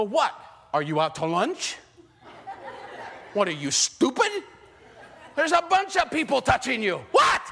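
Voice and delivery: high, laughing, rising to a shout; mocking disciples. Disciples mocking Jesus in the crowd, laughing incredulous shout. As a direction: play the exasperated disciples for laughs. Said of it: "the disciples, spiritual ones, go".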